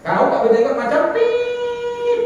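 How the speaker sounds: high, slow sing-song taunt; mock taunt. Worshippers quarrel over beards in the mosque, sing-song taunt. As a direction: sneer like a squabbling worshipper.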